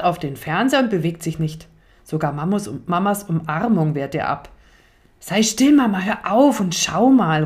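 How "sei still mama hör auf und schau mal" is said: lauter, drängender Kinderruf; aufgeregt. Acted. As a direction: ganz aufgeregt die Mama unterbrechen. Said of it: "ruft er aufgeregt". (Leo ruft Mama aufgeregt zum Fernseher)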